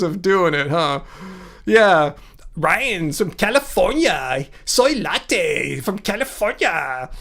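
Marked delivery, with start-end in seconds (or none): 2.5-7.1 slow, stretched-out mocking voice; soy latte voice. Streamer copying a critic's weird imitation of them, soy latte voice. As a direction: drag it out in a silly put-on voice. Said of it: "Honky tonk soy latte voice".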